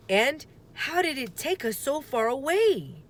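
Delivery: a lightly voiced puzzled boy; Jack, puzzled. Jack questioning his sister, lightly voiced puzzled boy.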